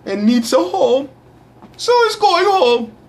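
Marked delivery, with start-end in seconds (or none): 1.8-2.9 mock voice. Mock announcer voice.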